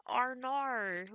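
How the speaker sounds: put-on a clumsy, half-hearted Australian accent; a throwaway stab at Australian; bad Australian accent. Imitating how Ben sounds in her head, a bad Australian accent. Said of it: "The most I can do is".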